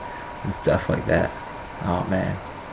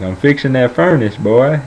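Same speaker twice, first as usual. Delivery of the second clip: higher-pitched mock Southern grandpa; old Southern grandpa; 6 semitones higher, 6 dB louder than usual. Caught grandpa explaining himself to grandson, old Southern voice. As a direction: imitate an old Southern man making an excuse. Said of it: "this is how he talks".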